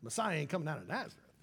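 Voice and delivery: quiet scoffing Nazareth, then fast dismissal; skeptical scoff. Retold or imitated. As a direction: brush it off like a doubter. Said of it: "And Nathanael goes".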